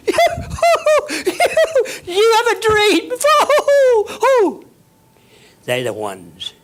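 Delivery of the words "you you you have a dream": shrieking mock laugh, much higher and louder; mocking laughter. The crowd mocking the dreamer, laughing high and loud. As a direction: cackle at the dreamer with scorn. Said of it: "kicks up their legs and laughs and says".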